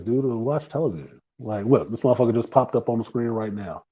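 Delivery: usual speaking voice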